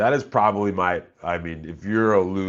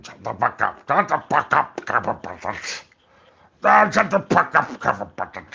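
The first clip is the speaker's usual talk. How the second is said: high, loud barking shut up; hammed-up unhinged yell; Mister Kill impression; 4 semitones higher, 4 dB louder than usual. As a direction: snap at Kevin in a manic character voice. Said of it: "in that Mister Kill mode".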